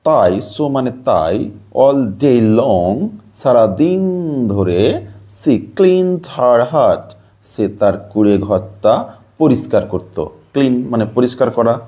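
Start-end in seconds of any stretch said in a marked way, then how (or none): 1.8-3.1 very slow, raised English reading voice; slow reading aloud. Shown as a demonstration. Teacher reading the lesson sentence to students, slow and clear. Reads the English text slowly. 5.4-7.1 very slow, raised English reading voice; slow reading aloud. Shown as a demonstration. Teacher reading the lesson sentence to students, slow and clear. Reads the English text slowly.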